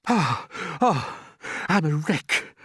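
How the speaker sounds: high put-on voice, groaning. A narrator's reading. Battered centipede complaining to friends, groaning. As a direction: moan about your aches. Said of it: "groaned the centipede".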